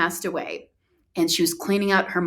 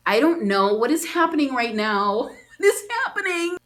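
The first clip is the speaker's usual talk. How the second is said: bewildered, climbing to a high pitch; mounting bewilderment; 5 semitones higher than usual. Retold or imitated. Retelling her shock at the travel guide, bewildered. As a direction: build from baffled to high-pitched disbelief.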